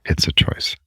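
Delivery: whispered "It's a choice"; hushed whisper, no voice. Real, not acted. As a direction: breathe the words as a whisper. Client repeats tapping phrase hushed.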